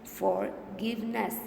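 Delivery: "Forgiveness" slowly as for, give, ness; teacher drawing apart each syllable of "Forgiveness"; split into syllables. Shown as a demonstration. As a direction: say "Forgiveness" in three separate beats. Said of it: "Three syllables".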